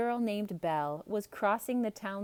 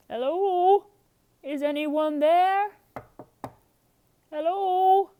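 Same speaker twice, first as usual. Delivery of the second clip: high, loud calling out; 10 semitones higher, 9 dB louder than usual. Acted. Maurice calling into the dark castle, loud and high. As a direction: call into the empty hall.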